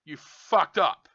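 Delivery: slow, loud blunt accusation. Real, not acted. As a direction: spit it out hard and slow. Opening outburst at the company.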